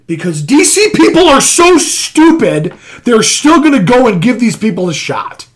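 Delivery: loud, high, drawn-out outburst; exasperated rant. Real, not acted. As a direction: belt it out slowly in exasperation. Exasperated rant about DC fans.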